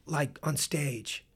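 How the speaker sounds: slow, quiet, lightly put-on showman voice; [name]'s voice. [name] clarifying to the young player, slow hushed voice. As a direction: draw it out softly like a knowing boss. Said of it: "He goes".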